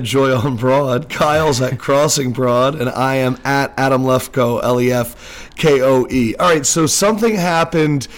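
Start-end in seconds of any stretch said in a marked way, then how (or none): none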